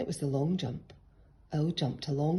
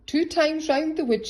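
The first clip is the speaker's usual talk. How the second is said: high, loud call; 12 semitones higher, 7 dB louder than usual. A narrator's reading. Miss Hoot calling out the last race to the runners. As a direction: call it across the field. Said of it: "she called".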